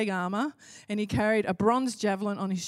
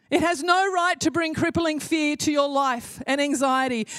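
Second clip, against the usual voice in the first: rising, louder preacher's build; rally the congregation, higher and louder; preaching at full force; 5 semitones higher, 4 dB louder than usual. Acted. Preacher urging the church to fight fear, full force.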